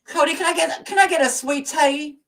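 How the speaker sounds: high-pitched hammed-up Southern accent. Calling to Cody for sweet tea, high Southern accent. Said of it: "My Southern accent is amazing".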